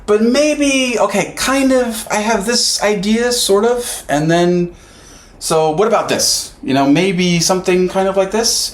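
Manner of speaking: high, tentative voice feeling out an idea; hesitant brainstormer. Retold or imitated. Imitating a colleague unsure of his idea, high and hesitant. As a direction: play someone timidly floating a suggestion.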